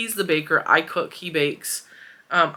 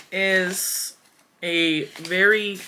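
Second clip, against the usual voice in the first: normal talking voice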